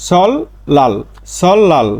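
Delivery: "সল লাল" with L in both syllables; first split, then join "সল লাল", no N; assimilated pronunciation. Shown as a demonstration. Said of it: "তাহলে এর উচ্চারণটা হবে". ("সল লাল" দুটোতেই ল দিয়ে উচ্চারণ)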